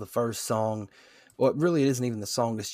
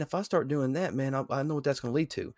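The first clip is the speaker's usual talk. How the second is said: ordinary delivery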